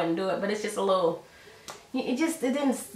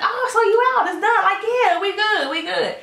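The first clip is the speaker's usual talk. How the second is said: a light put-on high voice for both; mock dialogue pitched much higher; high voiced quotes; 11 semitones higher than usual. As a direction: play the couple chatting in a raised voice. Voices Shonda and Willie chat.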